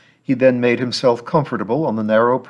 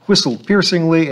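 ordinary delivery

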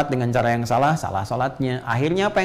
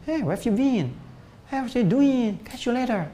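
higher-pitched imitation of a native English speaker; English accent; 10 semitones higher, slower than usual. Shown as a demonstration. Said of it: "Bahasa Inggris kayak orang Inggris". (menirukan logat orang Inggris)

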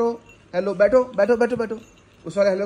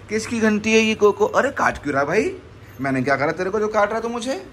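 ordinary talk

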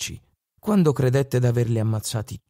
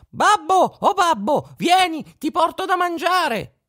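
very high, louder squeaky call; tiny voice calling out; 3 dB louder than usual. Acted. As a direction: call to daddy from under the basket. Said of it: "chiamò".

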